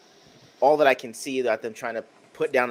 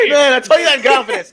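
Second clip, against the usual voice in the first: loud, high joking protest through laughter; laughing, hammed-up indignant yell; mock outrage, laughing; 14 semitones higher, 13 dB louder than usual. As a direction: shout a pretend betrayal while cracking up. Jokes back after being teased.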